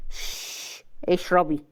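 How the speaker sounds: hushed shush, then low order; calm hush. A narrator's reading. Husband hushing wife to drink, calm. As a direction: silence her calmly. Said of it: "وقال بهدوء".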